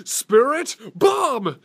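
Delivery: high anime battle yell; Goku shout. Pretend. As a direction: scream for energy like Goku. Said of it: "Dragon Ball Z".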